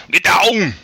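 Arnold shout. Pretend. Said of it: "to do Arnold".

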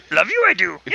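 put-on Muppet creature voice. Friend mocking the accented love request, Muppet voice. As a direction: play it as a silly Muppet. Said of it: "not a Muppet accent".

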